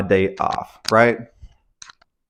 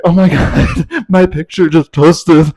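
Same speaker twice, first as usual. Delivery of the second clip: slow, high, louder voice breaking into a laugh; the penny drops, laugh it out; realizing, laughing; 7 semitones higher, 7 dB louder, slower than usual. Retold or imitated. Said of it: "And then I was like".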